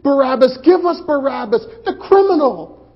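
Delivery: high, loud mob yell; crowd shouting. Retold or imitated. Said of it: "They said".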